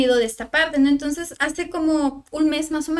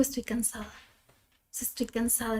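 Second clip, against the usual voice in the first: exhausted; 3 semitones lower, 12 dB quieter, slower than usual. Retold or imitated. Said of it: "le digo".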